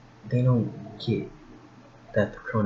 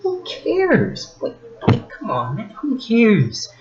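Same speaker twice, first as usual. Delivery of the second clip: high, loud, disbelieving outburst; exasperated who cares; 14 semitones higher, 7 dB louder, slower than usual. Real, not acted. Vlogger scoffing at a Karen's video, loud and incredulous. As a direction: throw up your hands in disbelief. Mocking a crying Karen's complaint.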